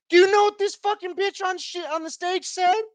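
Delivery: very high-pitched incredulous question. Retold or imitated. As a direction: ask it way up high in disbelief. Outraged build-up to the retold reply.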